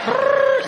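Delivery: high, trilled phone ring; mock phone ringing; phone ring imitation.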